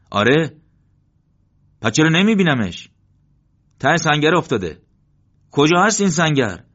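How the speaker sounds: loud, high shouted exchange; calling out. A narrator's reading. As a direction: shout it over the noise of battle. Loud exchange across the position.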